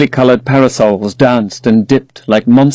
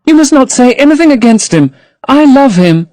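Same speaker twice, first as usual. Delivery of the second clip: put-on higher, young girl's plea; lightly raised girlish protest; Sybil crying out; 13 semitones higher, 4 dB louder than usual. A narrator's reading. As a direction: voice the eager young actress.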